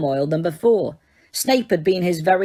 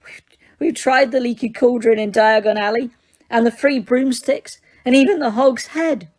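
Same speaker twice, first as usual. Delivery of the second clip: high, teary put-on plea; mock sobbing girl; 5 semitones higher than usual. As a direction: fake the tears. Hermione fake sobbing.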